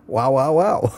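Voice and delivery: a dog barking wow with a lisp. Pretend. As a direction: bark the wows like a lisping dog. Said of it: "I'd be a dog with a lisp".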